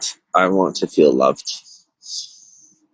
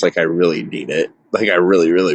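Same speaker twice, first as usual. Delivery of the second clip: usual speaking voice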